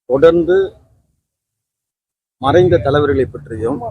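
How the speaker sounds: protest speech. Real, not acted. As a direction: deliver it to a crowd, phrase by phrase.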